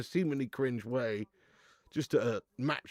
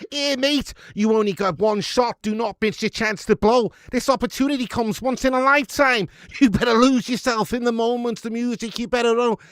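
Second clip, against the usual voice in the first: loud, high Scouse rant of rap lyrics; 6 semitones higher, 10 dB louder than usual. Pretend. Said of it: "Do some [name], but in Scouse".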